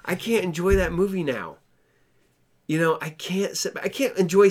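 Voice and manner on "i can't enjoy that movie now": exasperated disbelief. Retold or imitated. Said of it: "And it's like".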